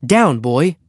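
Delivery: firm order to the dog; boy's firm command. A narrator's reading. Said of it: "said Henry".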